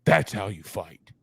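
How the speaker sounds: low-pitched impression of a huge coach; deep, imposing mock coach voice; intimidating coach. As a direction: drop the voice deep for the big coach.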